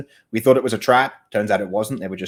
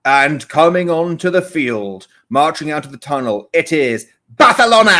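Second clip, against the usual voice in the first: hammed-up commentator; 3 semitones higher, 6 dB louder than usual. Mocking English commentators on La Liga, hammy announcer voice. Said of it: "put the most forced Spanish accent".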